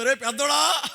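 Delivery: high-pitched call to her eldest son; mother calling out. Retold or imitated. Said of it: "అంటది". (తల్లి పెద్ద కొడుకుని ఎత్తైన స్వరంతో పిలుస్తూ)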